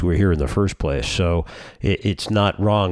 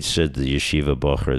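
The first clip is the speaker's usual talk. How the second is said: ordinary talk, nothing marked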